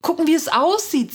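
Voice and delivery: hohe, leicht genervte Schwester; leicht angesäuert. A narrator's reading. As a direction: mit einem Anflug von Ungeduld nachhaken. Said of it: "sagt sie leicht angesäuert". (etwas eingeschnappt, in hoher Stimmlage)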